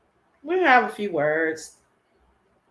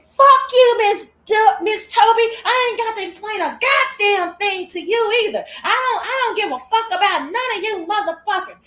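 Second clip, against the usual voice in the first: much higher and louder, screaming defiance; furious outburst; 13 semitones higher, 8 dB louder than usual. Retold or imitated. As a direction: blow up at her in a shrill rage. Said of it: "that motherfucker said".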